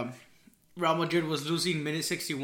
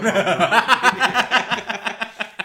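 laughing out loud; 6 semitones higher, 11 dB louder than usual. Real, not acted. Friend teases him about Dembele.

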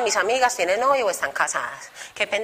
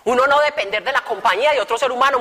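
normal talking voice

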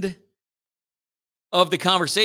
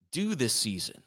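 soft, quiet echo of the last words as a question; sympathetic questioning echo; 3 semitones lower, 7 dB quieter than usual. Shown as a demonstration. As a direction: gently hand the words back, asking. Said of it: "You repeat the last two or three words?".

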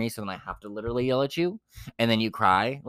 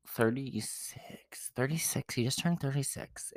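hushed, drawn-out disbelief; stretched-out, scandalized whisper; incredulous whisper; 2 semitones higher, 8 dB quieter, slower than usual. Real, not acted. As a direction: whisper the age as if appalled. Incredulous rebuke over his age.

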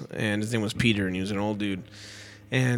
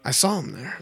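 ordinary talk

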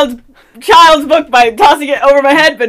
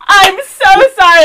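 louder, overdone apology with a laugh; loud fake apology; 5 semitones higher, 4 dB louder than usual. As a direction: ham up the apology. Loud fake apology, second bump.

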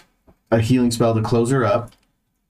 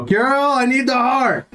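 booming magic voice; 12 semitones higher than usual. Acted. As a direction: call out as if across a dungeon. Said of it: "my voice now goes out to three hundred feet".

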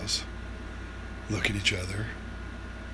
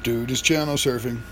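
ordinary talk, nothing marked

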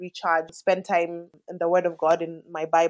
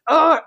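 loud, high frustrated ugh; exasperated groan; 8 semitones higher, 10 dB louder than usual. Retold or imitated. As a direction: let out a fed-up mother's groan. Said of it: "I was just like".

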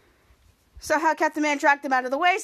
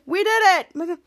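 louder, triumphant exclamation; celebrating; 3 semitones higher, 5 dB louder, much slower than usual. Retold or imitated. Kid Danger up the tube after success, celebrating. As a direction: cheer it like a small victory.

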